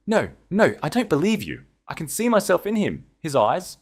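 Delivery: high, frantic refusal; desperate denial. A narrator's reading. Duke refusing Eleanor's words, high and frantic. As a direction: refuse it, rising in panic.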